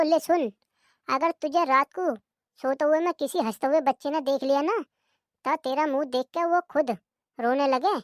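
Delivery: usual speaking voice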